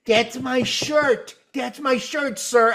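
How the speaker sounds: high-pitched mock female voice; pleading girl imitation. Girl tapping the fan for her shirt, mock pleading.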